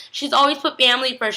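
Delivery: usual speaking voice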